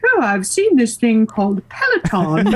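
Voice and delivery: husband's British accent, imitated; bad British accent. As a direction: put on a hammy British accent. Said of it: "He thinks my British accent's terrible".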